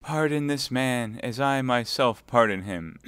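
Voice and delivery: high, meek plea; angelic meekness. Acted. Milady feigning meek prayer before the Baron. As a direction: pray with saintly humility. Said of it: "with an angelic meekness of gesture and tone".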